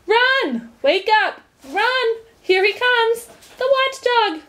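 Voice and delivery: high, loud panicked crowd cries; panicked shouts. A narrator's reading. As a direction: yell the warning as you flee in terror. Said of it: "Great shouts filled the air".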